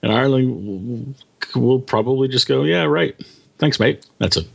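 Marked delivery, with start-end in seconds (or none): 2.6-4.0 hammy, bad Irish accent; a lightly put-on Irish accent; terrible Irish accent. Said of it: "terrible Irish accent".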